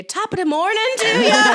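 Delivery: hammed-up Irish greeting, high and loud; big stage-Irish holler; Irish accent. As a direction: belt out a mock Irish top o' the morning. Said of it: "Irish porn".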